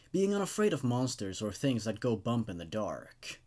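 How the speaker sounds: calm, even reading of a creepy tale; story narration. A narrator's reading. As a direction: tell it like a campfire story, steady and measured. Reads the creepypasta aloud.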